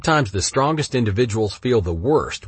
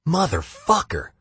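exasperated curse; 9 semitones higher than usual. Real, not acted. Frustrated curse chasing the intruder.

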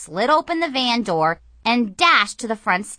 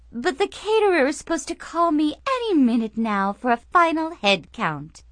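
frantic society lady; 8 semitones higher, 4 dB louder than usual. Acted.

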